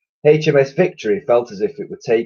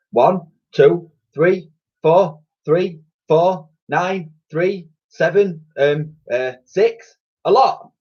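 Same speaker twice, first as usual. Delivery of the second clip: put-on childlike counting; 9 semitones higher, 4 dB louder, slower than usual. A narrator's reading. Dotty counting wrongly.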